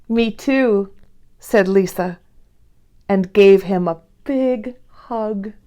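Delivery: gentle read-aloud storytelling voice; lively read-aloud for young listeners; story narration. A narrator's reading. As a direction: read it like a bedtime picture book for kids. Reads Corduroy story aloud.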